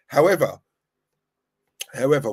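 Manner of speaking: normal talking voice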